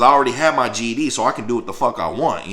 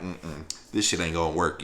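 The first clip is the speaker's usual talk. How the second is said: low, much softer resignation; quiet retold resolve; 7 semitones lower, 10 dB quieter than usual. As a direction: say it quietly to yourself.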